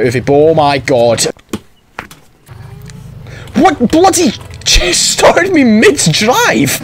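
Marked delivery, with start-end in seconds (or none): 3.5-6.8 high, drawn-out exasperated cry; slow, high-pitched outrage; outraged. Real, not acted. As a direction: stretch it out in high disbelief. Outraged at the game.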